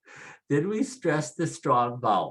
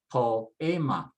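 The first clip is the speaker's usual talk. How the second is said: "poema" as po-E-ma, weight on the E; slow, separated syllables in "poema", middle one stressed; next-to-last stress. Shown as a demonstration. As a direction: split o and e, lean on the E in "poema". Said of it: "I would stress next to the last".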